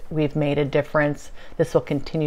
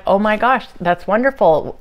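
retold delight; 4 semitones higher, 6 dB louder than usual. Retold delighted reply to the being.

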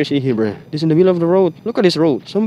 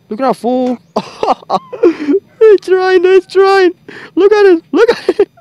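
high, loud words through helpless laughter; cracking up while pointing it out; laughing hard; 13 semitones higher, 7 dB louder, slower than usual. Real, not acted. Laughing at game character's fall.

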